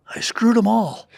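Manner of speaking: a breathy, lightly imitated old star line; breathy Clint Eastwood impression. Clint breathily answering his bar companion. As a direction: let it out on a breath after a pause. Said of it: "breathy like that".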